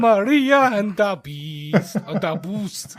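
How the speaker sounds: slow, drawn-out bad German accent; mock German, long drawn syllables; terrible German accent. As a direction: put on a hammed-up German accent, stretching the vowels. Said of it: "do my terrible German accent".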